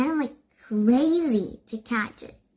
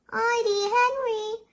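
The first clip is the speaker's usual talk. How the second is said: names called out high; calling out; 11 semitones higher than usual. A narrator's reading. Aunt waving the kids over from her porch, high calling out. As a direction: call their names up high across the street. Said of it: "a voice called".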